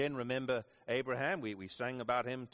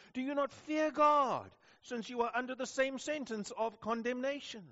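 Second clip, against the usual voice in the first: rebuking; 11 semitones higher than usual. Retold or imitated. Thief rebukes the other thief, peak of his rebuke. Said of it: "he rebukes the first thief".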